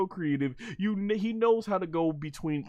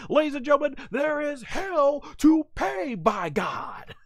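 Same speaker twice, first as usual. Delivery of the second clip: much higher, louder wrestling commentator call; Jim Ross; 10 semitones higher, 3 dB louder than usual. Retold or imitated. Fan imitates a wrestling announcer, booming call. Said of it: "As the great Jim Ross would say".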